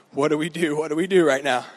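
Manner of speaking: low, soft retold panic; hushed worry. Trapped boys conferring in the car, hushed and worried. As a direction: murmur it low to your friends. Trapped friends consulting each other.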